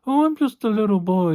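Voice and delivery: put-on high, meek little-boy voice; Ed Gein's meek voice. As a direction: imitate the killer as a timid child. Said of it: "He, he talks like that".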